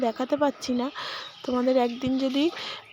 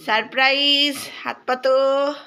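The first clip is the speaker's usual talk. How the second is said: excited surprise; 4 dB louder than usual. Real, not acted. Shows child a surprise.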